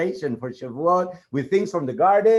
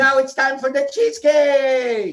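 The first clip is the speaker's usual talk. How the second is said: high puppet voice calling out; a puppet bursting with excitement; 7 semitones higher than usual. Acted.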